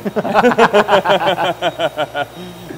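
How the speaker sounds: long loud burst of laughter. Real, not acted. Big laugh at own joke. (gros éclat de rire)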